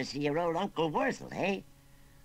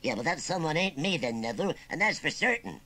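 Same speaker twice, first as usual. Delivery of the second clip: firm rustic refusal; hard-edged; 8 semitones higher, 6 dB louder than usual. Acted. Uncle refusing his nephew's work, hard-edged. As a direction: put a slight hard edge on it. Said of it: "A hard edge crept into Wurzel Gummidge's voice".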